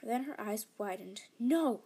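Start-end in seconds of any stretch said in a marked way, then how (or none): 1.4-1.8 loud sharp cry of no; shout. Acted. Sister shouting to stop her father: loud no. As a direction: yell it to stop him. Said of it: "she shouted".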